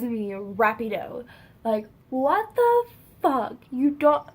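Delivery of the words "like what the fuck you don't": high, loud, drawn-out mock outrage; indignant outburst. Friend texting a runaway in disbelief, indignant outburst. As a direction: act out his outrage, slow and raised. Damien's frantic texts voiced, indignant.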